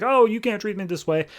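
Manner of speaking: high-pitched mock yell, no real volume; half-voiced protest pitched well up; retold yelling. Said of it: "as he's yelling at this guy over the screen".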